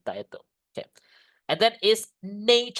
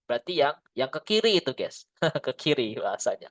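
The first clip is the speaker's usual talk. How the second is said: ordinary talk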